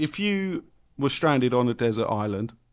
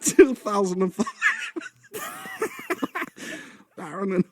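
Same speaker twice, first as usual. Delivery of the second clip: bad Welsh accent; 7 semitones higher than usual. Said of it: "such a bad fucking accent".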